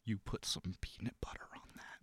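whispered, conspiratorial impression; George Washington Carver. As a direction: whisper it like a secret tip. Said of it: "Give me your best George Washington Carver".

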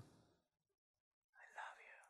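slow shy whisper; whispered very slowly. Acted. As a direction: breathe it out like a bashful child. Shy grandkids answering the hug.

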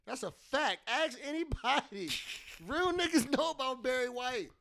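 usual speaking voice

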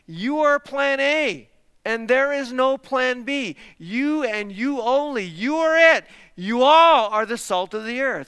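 slow, loud, high stress on "you"; stretched, forceful declaration punching "You"; emphatic YOU. Shown as a demonstration. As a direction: drive home every "you" emphatically. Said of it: "extra emphasis on the you".

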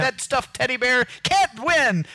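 mock denial. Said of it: "I was like".

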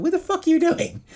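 high-pitched, loud mock yell; mock outrage. Podcaster imitating co-host catching him getting high, high outraged yell. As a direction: squawk it like an annoyed housemate. Acts out co-host's outraged reaction.

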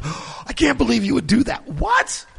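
high, gasping disbelief; mock surprise. Housemates reacting when someone lies, mock shocked gasp. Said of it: "they all are surprised when someone lies".

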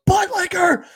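high, strained mock shout, drawn out; retold outburst. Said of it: "Dwight eventually just goes".

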